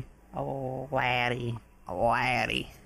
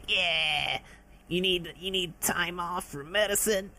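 higher-pitched cocky jerk-boss act; douchebag CEO; 4 semitones higher than usual. Acted. Sonic as CEO brushing off staff requests, douchebag act. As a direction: scoff through it like an obnoxious executive. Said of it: "one of those douchebag ones where he's like".

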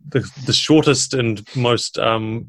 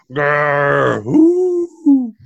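owl bear call; 3 semitones higher, 5 dB louder than usual. Acted. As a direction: growl sliding into a high hoot. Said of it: "it calls out".

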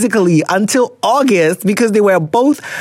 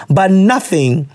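normal talking voice

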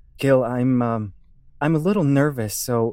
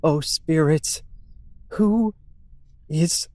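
slow, solemn call to the spirits; ritual invocation; 2 semitones higher, 4 dB louder than usual. Acted. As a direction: intone it like a séance leader.

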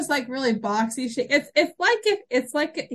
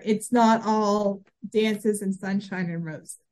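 normal talking voice

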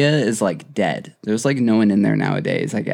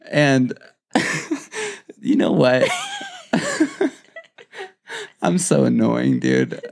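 ordinary delivery

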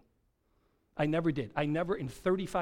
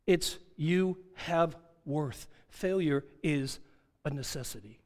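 slow emphasis. Real, not acted. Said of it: "slow emphatic line".

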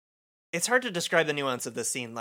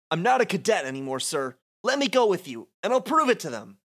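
higher and louder as the plea builds; begging to join the fight; eager pleading; 7 semitones higher, 3 dB louder, faster than usual. Acted. Cadet begging his commander to let him fight, rising plea.

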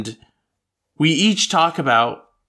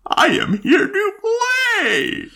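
creepy shriek. Pretend. Creepy voice shouted.

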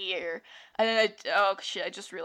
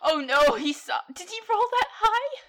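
panicked; 12 semitones higher, 7 dB louder than usual. Real, not acted. Player panics at being seen.

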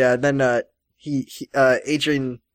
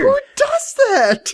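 very high, slow exasperated question; squealed, stretched-out disbelief; incredulous outcry; slower than usual. Real, not acted. Incredulous rant about absurd plot.

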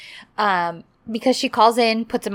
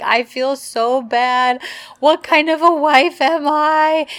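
higher, drawn-out imitation of distress; mock tearful wail; 6 semitones higher, 3 dB louder than usual. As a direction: playfully wail in mock guilt. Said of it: "She's crying to Aidan".

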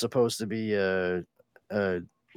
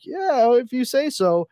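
skeptical; 11 semitones higher, 4 dB louder than usual. Retold or imitated. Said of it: "I was like".

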